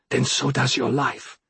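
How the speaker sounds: plain reading voice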